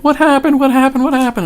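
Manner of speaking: mock frantic what happened; panicked questions. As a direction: play the scared kids demanding answers. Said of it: "they're like".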